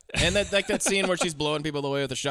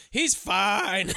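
mock reassuring; 5 semitones higher than usual. Mock reassurance, movie ending.

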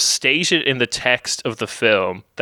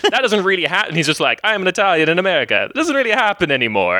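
higher, fast, hammed-up Italian accent; Mamma Mia Italian accent; 8 semitones higher, faster than usual. Mocking the BoJack Italian chef, fast higher hammed-up Italian accent. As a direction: rattle it off like a cartoon Italian chef in a put-on accent. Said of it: "Mamma Mia Italian accent".

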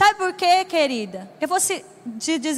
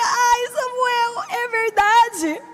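slow, higher, choked-up "Ai, Samuel"; touched admission; 6 semitones higher, much slower than usual. Retold or imitated. Mother admits fault to her son, slow and tearful. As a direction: draw it out, tearful and tender.

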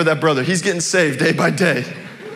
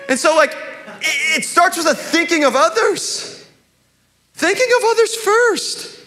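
high, emphatic exhortation; impassioned preaching; 9 semitones higher than usual. Real, not acted. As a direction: preach it earnestly, pitched well above your talk.

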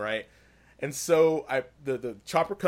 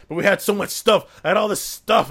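higher, louder mock complaint; dramatic whining; 6 semitones higher, 8 dB louder than usual. Joe moaning about his lost loot, hammed-up whine. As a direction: whine it like a sore loser. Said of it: "God, you make me sound so dramatic".

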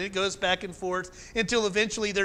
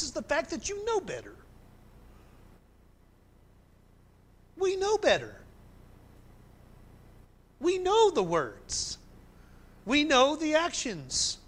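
higher, slowed, hammering each know; full-force preaching; 8 semitones higher than usual. Acted. Pastor pressing his congregation, preaching at full force. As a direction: drive it home from the pulpit.

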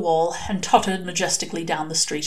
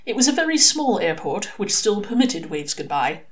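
ordinary delivery, nothing marked

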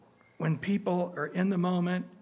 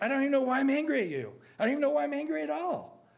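high, fast, exasperated acted outburst; agitated, angry; 11 semitones higher, faster than usual. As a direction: act worked up, rushing the words at a raised pitch. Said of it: "I sound agitated and hateful and angry".

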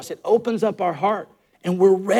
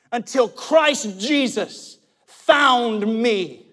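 impassioned preacher, raised and drawn out; preaching; 11 semitones higher, 8 dB louder, slower than usual. Real, not acted. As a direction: preach it with conviction, stretching the key words. Preaches at full force.

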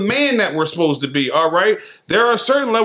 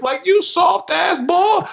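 mock scorn; 13 semitones higher than usual. Mocking a soft friend.

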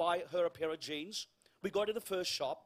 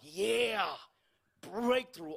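excited outward cheer; much slower than usual. Retold or imitated. Pastor showing outward joy at a healing, excited cheer. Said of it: "I was excited".

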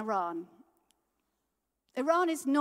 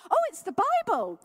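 put-on old lady answering; 10 semitones higher, 7 dB louder than usual.

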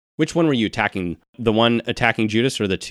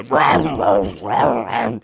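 imitated snarling zombie growls; zombie noises. Game master mimics the two zombies in the room, snarling growls. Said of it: "you can hear just the".

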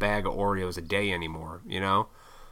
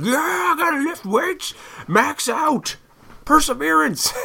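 much higher, pumped-up gym bro voice; mock gym bro; 5 dB louder than usual. Mocking an obsessive gym guy, high hammed-up bro voice. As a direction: ham up a meathead at the gym.

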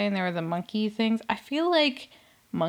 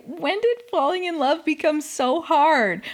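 high-pitched imitation of a tearful lament; mock crying; 8 semitones higher than usual. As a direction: wail it, mocking her interview. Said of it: "she's crying in an interview".

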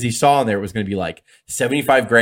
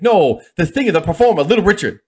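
exasperated booker, higher and louder; Dusty Rhodes; 7 semitones higher, 5 dB louder than usual. Retold or imitated. Booker sets the confused wrestler straight, imitated with raised voice. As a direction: correct him in a raised, put-on voice. Dusty corrects Mero's misunderstanding.

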